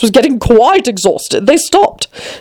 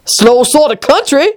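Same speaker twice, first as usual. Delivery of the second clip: higher, disdainful queen; dismissive Red Queen; 4 semitones higher than usual. Acted. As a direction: scoff like an imperious queen. Queen's scornful reply.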